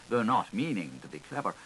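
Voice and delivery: usual speaking voice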